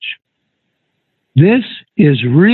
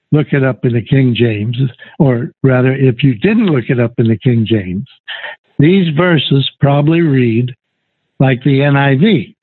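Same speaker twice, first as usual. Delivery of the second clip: usual speaking voice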